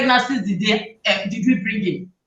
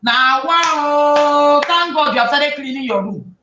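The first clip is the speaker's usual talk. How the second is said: over the top praise; slower than usual. Pretend. Parent bursting into the clean room, overdoing sing-song praise. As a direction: gush too much and spoil the moment. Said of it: "going to kill it the moment you enter".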